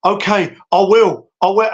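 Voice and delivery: lightly imitated chastened fighter giving in; Klitschko. Scolded fighter answering his coach, mildly imitated compliance. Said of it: "and Klitschko was going".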